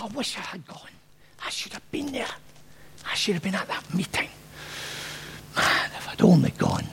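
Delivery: hushed, grumbling whisper of self-blame; moaning to himself. Retold or imitated. Thomas in the corner, scolding himself in a whisper. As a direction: mutter it under your breath, regretful. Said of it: "moaning, grumpy, chastising himself".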